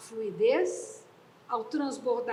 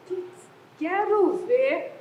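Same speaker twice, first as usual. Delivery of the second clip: mock challenge; 4 semitones higher, 6 dB louder, much slower than usual. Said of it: "Ele entra e fala".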